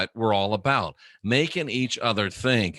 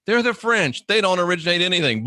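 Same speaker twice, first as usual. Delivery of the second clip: a comedian's quick raised-pitch dig at the French; mocking jab; 5 semitones higher, faster than usual. Real, not acted. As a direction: toss off the punchline with a sneer. Punchline joke about the French.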